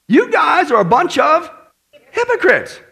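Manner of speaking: high, loud, drawn-out accusation; indignant rebuke. Acted. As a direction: build up to a high-pitched "hypocrites". Voicing Jesus's rebuke to skeptics.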